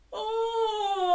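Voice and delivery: loud open-throated oh; big open oh like at the doctor's. Shown as a demonstration. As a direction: open your throat and call out oh. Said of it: "Gonna open our throat".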